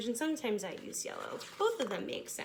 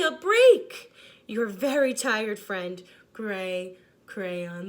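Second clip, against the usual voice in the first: lightly voiced weary crayon with a few sudden louder outbursts; tired gray crayon; 10 dB louder, slower than usual. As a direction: sigh through the letter like an overworked crayon.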